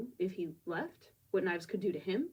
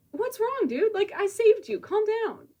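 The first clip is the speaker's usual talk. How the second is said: high, louder, breezy mock-casual; unbothered casual; 14 semitones higher, 9 dB louder than usual. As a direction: brush off his brother's panic like it's nothing. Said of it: "disconcerting how unbothered he is".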